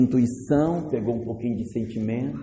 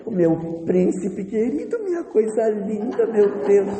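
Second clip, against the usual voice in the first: tender mother; 7 semitones higher than usual. Acted. Mother cuddling baby at night.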